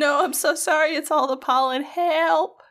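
high, mock-desperate apology; hammed-up plea. Co-host playing along with the pollen excuse, mock pleading.

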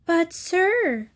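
high, timid little lamb voice; meek lamb. A narrator's reading. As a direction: speak up small and humble to the wolf. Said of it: "replied the meek lamb".

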